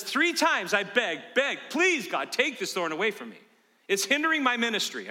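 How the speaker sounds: loud, high imitated begging; begging Paul. Preacher imitating Paul begging God to take the thorn, loud and high. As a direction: plead like Paul, over the top. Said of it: "Paul says".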